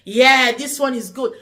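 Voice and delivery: hammed-up vain crier, giggling through it; mocking impression. Vlogger mocking people who film themselves crying, giggly mimicry. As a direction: play someone admiring their own tears on camera, laughing. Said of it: "and be like".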